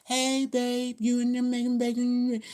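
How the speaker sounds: a partner calling out about bacon, high and loud; teasing partner's voice. Retold or imitated. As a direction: sing out to someone in the kitchen. Partner's voice overheard on call.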